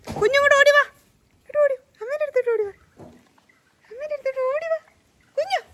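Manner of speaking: high, loud sing-song call; calling the pet. Real, not acted. Owner calling her pet to run to her, loud high sing-song.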